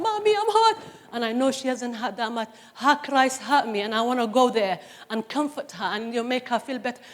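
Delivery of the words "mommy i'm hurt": put-on high, whiny little-girl plea; crying little girl. Mother voicing her crying daughter calling Mommy, high and plaintive. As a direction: play the hurt child calling for mommy. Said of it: "she falls down and she's crying".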